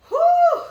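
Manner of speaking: a big high exhale of disbelief; loud whew. Real, not acted. Narrator reacting as the rival walks in, loud whew. Exclamation before climax.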